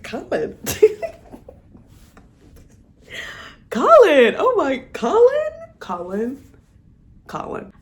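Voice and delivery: swooning sighs of his name. Real, not acted. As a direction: call his name, lovestruck. Swooning over Colin.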